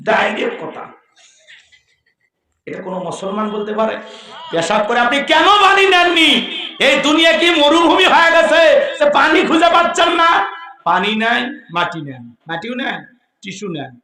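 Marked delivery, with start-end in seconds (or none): 4.5-10.4 scolding rebuke. Acted. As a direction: scold the listeners from the pulpit. Preacher scolding the congregation.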